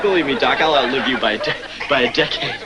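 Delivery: normal talking voice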